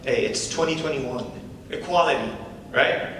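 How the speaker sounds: lightly voiced comedy-club patter; stand-up comic. [name] opening his set to the crowd, casual comic patter. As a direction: work the crowd with an easy opener.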